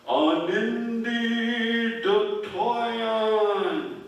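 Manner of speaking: eerie, long-drawn spirit call; high, loud chant stretched very slowly; old strange voice. A narrator's reading. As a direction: wail it out like a spirit calling through woods. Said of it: "and they hear".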